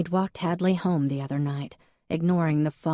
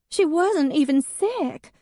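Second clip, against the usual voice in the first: high, louder, tearful wail; 12 semitones higher, 5 dB louder than usual. Acted. Hadley to Jack about her dead cat, weeping high. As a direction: wail it like the world has ended.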